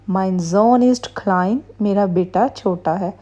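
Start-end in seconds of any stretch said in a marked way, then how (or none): 0.1-1.6 very slow, careful German; slow teacher demo of a German phrase; slow model reading. Shown as a demonstration. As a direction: read slowly so learners can copy. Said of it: "teacher reads German model sentence".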